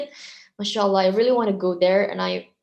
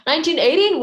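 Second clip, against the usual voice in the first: flustered correction; 8 semitones higher, 7 dB louder, much faster than usual. Real, not acted. Flustered correction of own birth year.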